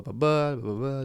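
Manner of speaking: ordinary delivery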